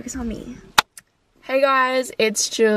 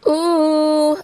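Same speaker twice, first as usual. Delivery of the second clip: delighted high ooh; 6 semitones higher, 4 dB louder than usual. Real, not acted. Tasting the drink.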